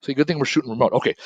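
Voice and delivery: ordinary talk, nothing marked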